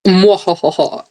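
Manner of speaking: slow, drawn-out villain cackle; evil laugh. Pretend. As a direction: cackle like a sneering panto villain. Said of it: "Evil laugh".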